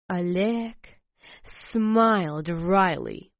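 ordinary delivery, nothing marked

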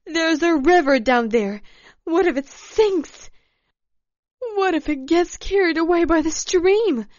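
high, loud, panicked outburst; frantic, high-pitched shouting; almost screaming. A narrator's reading. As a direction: cry it out at the edge of a scream. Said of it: "Svetlana was almost screaming".

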